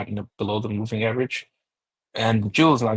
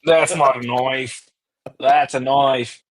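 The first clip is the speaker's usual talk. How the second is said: slow, higher mock Australian drawl; Crocodile Dundee impression; 4 semitones higher, 6 dB louder, much slower than usual. Quoting Crocodile Dundee in a mock Australian accent. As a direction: ham up the Aussie knife line. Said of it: "is that from Crocodile Dundee?".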